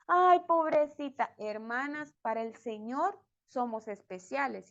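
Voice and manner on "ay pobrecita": mock pity. Mocking the Lord's pat on head.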